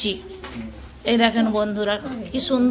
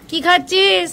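to someone hard of hearing; 5 semitones higher, slower than usual. Real, not acted. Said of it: "ঠাকুমা তো কানে শুনতেই পাবেনি".

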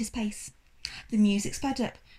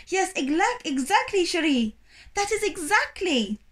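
put-on French-accented call; 8 semitones higher, 3 dB louder than usual. French owner calls over music.

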